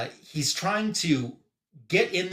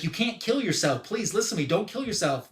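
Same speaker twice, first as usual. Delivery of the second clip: higher-pitched retold plea; urgent pleading; 6 semitones higher than usual. Superman begs the attackers not to die, pleading. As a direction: plead with them, voice lifted on each phrase.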